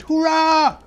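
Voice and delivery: Tiny Tim cries out with joy; small boy shouting hurrah, thrilled; excited cheer. Acted. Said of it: "and cried".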